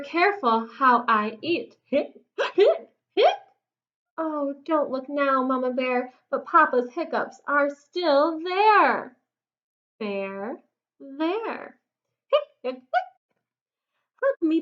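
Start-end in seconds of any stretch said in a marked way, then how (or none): 1.8-3.4 high, sharp mock hiccups; hiccupping. Papa Bear's hiccups. 12.3-13.1 high, sharp mock hiccups; hiccupping. Papa Bear's hiccups.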